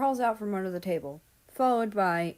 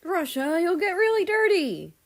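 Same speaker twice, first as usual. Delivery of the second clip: high, louder fretting friend; worried Amei; 11 semitones higher, 8 dB louder than usual. Acted. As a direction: fuss over him anxiously, pitched up. Amei voiced, higher and louder.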